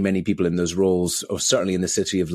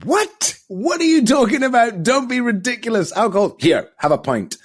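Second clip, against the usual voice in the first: much higher, mocking disbelief; incredulous colleagues; 4 dB louder than usual. Colleagues scoffing at his plan to drink less, high and mocking. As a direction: play the scoffing drinking buddies, pitched way up. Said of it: "they were like".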